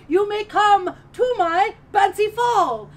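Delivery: slow, high and loud like a proud prince; royal announcement. Acted. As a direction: proclaim it grandly to the whole kingdom.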